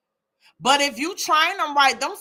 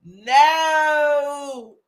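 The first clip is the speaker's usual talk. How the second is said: usual speaking voice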